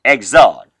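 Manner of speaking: Exode said "eg-ZOD"; low, firm Exode, second syllable falling; French pronunciation. Shown as a demonstration. Teacher shows beginners how French says it, Exode as "eg-ZOD". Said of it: "is pronounced just at the way".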